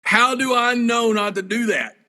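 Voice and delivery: high, drawn-out exasperated protest; retold exasperation. Detained wrestler answering the man's warning, exasperated.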